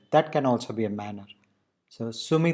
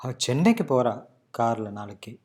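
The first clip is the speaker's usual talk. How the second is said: strong first part, let கார்ல நாளைக்கு fall away; stress on சென்னைக்கு; 2 semitones lower, much faster than usual. Shown as a demonstration. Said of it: "strong intonation in the first part".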